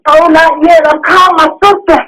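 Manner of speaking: high-pitched pleading; frantic, helpless plea; distraught, desperate. Real, not acted. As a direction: urge and beg in real panic, voice held high. Begging while attempting CPR alone.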